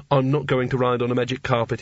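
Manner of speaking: ordinary delivery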